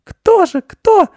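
put-on piercingly high, loud little-girl squeal; very high child shriek of curiosity; excited squeal. A narrator's reading. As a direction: squeal it like an eager small girl. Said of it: "снова взвизгнула Анечка".

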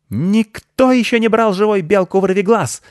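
high, loud squirrel battle cry; piercing, boastful howl; wailing yell. A narrator's reading. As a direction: scream defiance while escaping. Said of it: "завопила она".

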